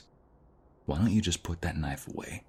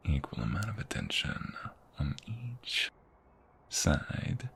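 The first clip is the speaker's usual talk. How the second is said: slow, soft whisper between kisses; intimate ASMR whisper; 6 dB quieter, much slower than usual. Acted. Boyfriend kissing his partner's cheeks and lips, whispering softly. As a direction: keep it hushed and unhurried, close to her ear.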